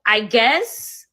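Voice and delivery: drawn-out Beverly Hills "guess", rising at the end; Beverly Hills accent. Real, not acted. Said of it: "sound like it came from Beverly Hills".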